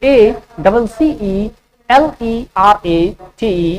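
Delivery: slow letter-by-letter dictation; slow spelling dictation; spelling out slowly. Shown as a demonstration. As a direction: spell each letter clearly for students. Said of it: "accelerate का spelling क्या होगा".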